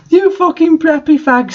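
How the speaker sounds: hammed-up rage at the preps; angry shout. A narrator's reading. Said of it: "Sirius shouted angrily".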